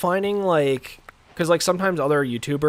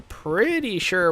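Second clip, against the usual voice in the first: voice crack; 7 semitones higher, 5 dB louder, much slower than usual. Real, not acted. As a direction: voice cracking mid-sentence. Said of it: "Wow, that was a voice crack".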